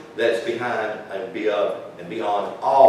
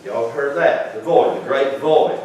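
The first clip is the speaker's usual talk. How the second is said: normal talking voice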